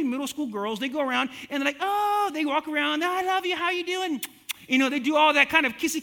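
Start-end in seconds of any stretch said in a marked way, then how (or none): 1.8-2.3 high, drawn-out fake-gushy "oh"; mock a girl's syrupy greeting squeal; gushing schoolgirl. Preacher mimics middle school girls greeting each other, fake gushing. 3.0-4.2 high, sugary fake affection; fake-sweet schoolgirl. Preacher mimics girls who fake their love, sugary and high.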